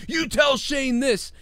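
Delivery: high, slow mock command; hammed-up bossy order; Kurt Angle. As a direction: draw out each word, taking charge. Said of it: "Kurt Angle took over the whole thing".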